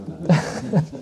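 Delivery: a short, soft amused laugh. Real, not acted. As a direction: laugh quietly at the tongue-twister. Laughs after one-syllable verse.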